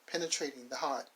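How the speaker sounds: usual speaking voice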